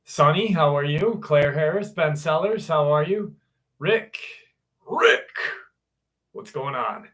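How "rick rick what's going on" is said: Negan impersonation. Retold or imitated. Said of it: "That was my best Negan impersonation".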